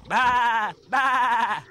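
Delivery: high bleating baa baa; sheep bleat. A narrator's reading. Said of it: "said the sheep".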